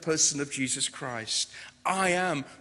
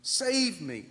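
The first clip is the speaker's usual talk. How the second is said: higher, slow plea of "Lord, save me"; frightened cry; 8 semitones higher, slower than usual. Acted. Sinking Peter pleads with Jesus, higher and slow. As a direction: cry out as a man sinking, drawn out. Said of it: "cried out".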